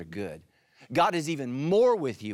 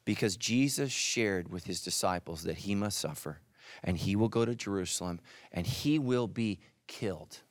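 ordinary talk, nothing marked

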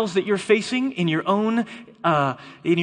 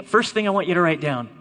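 usual speaking voice

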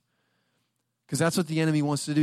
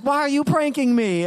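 much higher, loud exasperated wife; a wife finding a fortune on her pillow, annoyed; mock angry yell; 11 semitones higher, 5 dB louder than usual. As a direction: call him out sharply. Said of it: "she gets so mad".

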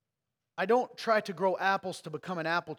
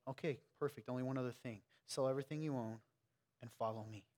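softer, gentle hush; very quiet; 3 semitones lower, 7 dB quieter than usual. Retold or imitated. Jesus speaking to the rich young ruler, very quietly. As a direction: say it very quietly, almost tender. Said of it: "probably said it very quietly".

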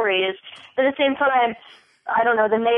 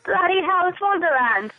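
put-on British accent; 9 semitones higher than usual. Gushing about Wonderland, British-sounding.

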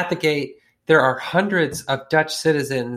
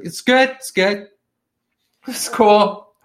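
sheepish bluffing bro; 7 semitones higher, 4 dB louder than usual. Retold or imitated. Colonel bluffing his general.

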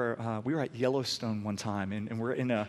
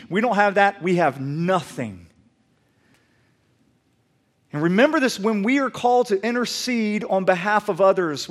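loud, high, impassioned sermon delivery; full-force preaching; 8 semitones higher, 12 dB louder than usual. Acted. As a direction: preach it out to the whole congregation with urgency.

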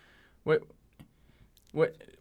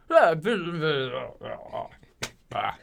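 mumbled gibberish impression; imitating an athlete's garbled talk; Westbrook; slower than usual. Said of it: "who else has a voice like that?".

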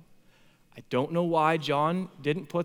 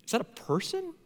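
wondering aloud; 7 semitones higher, 6 dB quieter than usual. Retold or imitated. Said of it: "like, it's a".

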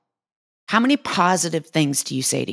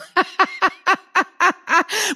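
high, rapid string of giggles; real laugh; 12 semitones higher than usual. Real, not acted. Trainer amused by her own flat butt joke, real laugh. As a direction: burst into a high cackling laugh.

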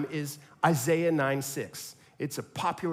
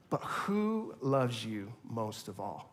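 soft, slow and lower; tender question; 7 semitones lower, 8 dB quieter, slower than usual. Retold or imitated. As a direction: ask it gently like a bedtime question. Tender closing question to kids.

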